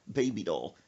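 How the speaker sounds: ordinary talk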